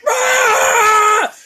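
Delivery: imitating the dad's raw cathartic yell; mock yell of release. Retelling the dad's yell to his son, a big primal shout. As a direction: let out one big primal "Ah!". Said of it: "Dad's like".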